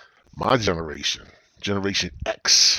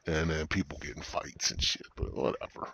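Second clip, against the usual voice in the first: ordinary talk, nothing marked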